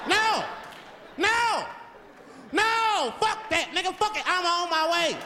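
very high, loud outburst; agitated yelling. Acted. Limousine driver hearing bad news on his phone, high agitated yelling. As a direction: explode into the phone.

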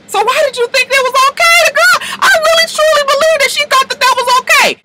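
high disbelieving question; disbelief. Real, not acted. Vlogger stunned by [name], high disbelief. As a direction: ask it stunned.

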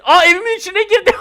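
high, loud, laughing exclamation; excited shout tipping into laughter; thrilled surprise. Real, not acted. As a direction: cry out with delighted amazement. Gets inside the house.

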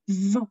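"v-" as a sustained voiced buzz; elongated V. Shown as a demonstration. Conductor shows starting the sound sooner, "v-" drawn out. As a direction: hold the "v-" before any vowel comes. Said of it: "start the V sound sooner".

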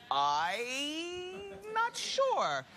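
high, very slow, whiny "I'm not sure"; drawn-out mock doubt. Host to audience, teasing about accents, mock-pained drawl. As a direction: stretch it out like a pained fake sob.

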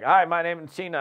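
imitating a stiff, rushed phone intro; mock robotic sales-call greeting; fast robotic script reader. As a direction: rattle it off like a robot reading a script. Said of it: "talking really fast ... like a robot".

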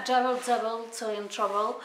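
put-on a French speaker putting on a playful English accent for a book title; mock English accent. Booktuber reading the category name to viewers in a mock English accent. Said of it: "mon super accent anglais".

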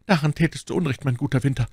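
high, gentle-hearted nobleman's voice; wounded duke, consoling. A narrator's reading. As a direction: console a friend from the sickbed, pitched high. (verwundeter Herzog, tröstend)

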